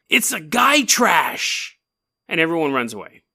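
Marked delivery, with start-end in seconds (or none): 0.1-1.8 slow, hammed-up British accent, a bit higher; spooky British whisper-drawl. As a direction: draw out each word like a fearful British local. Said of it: "And he goes".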